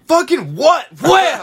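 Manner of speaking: high, loud startled yelp. Retold or imitated. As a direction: jump at being called. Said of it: "he turns, he goes".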